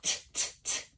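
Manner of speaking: T, t, t as soft voiceless taps; whispered t sounds; sounding out. Shown as a demonstration. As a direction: tap out the t sound quietly. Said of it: "there's that O again".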